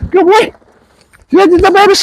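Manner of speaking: high-pitched excited praise; real high, eager cheering to a dog. Real, not acted. Trainer praising dog in play.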